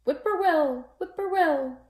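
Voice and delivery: high, sung bird call; whippoorwill call. Acted. Whippoorwill calling over the dark field, high and sung. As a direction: sing it out like the night bird. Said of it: "A whippoorwill sang out".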